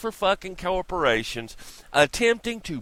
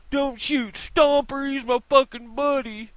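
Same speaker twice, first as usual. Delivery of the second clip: mocking protester; 10 semitones higher than usual. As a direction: mimic a pleading buddy, pitched way up.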